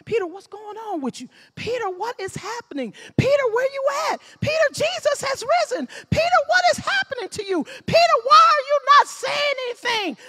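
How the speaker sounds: high, loud pleading calls; pleading shout. Acted. Disciples begging the silent Peter to speak, high pleading shouts. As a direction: cry out desperately for him to answer.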